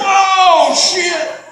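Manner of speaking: high, loud, slow excited yelling; excited friend shouting. Retold or imitated. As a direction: shout it like you can't believe your eyes. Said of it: "And I'm like".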